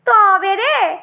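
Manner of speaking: scrappy dare, pitch climbing at the end; glaring challenge. Acted. Ridge gourd dares the pointed gourd to hit, combative. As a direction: square up for a fight. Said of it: "চোখ কটমট করে বললে".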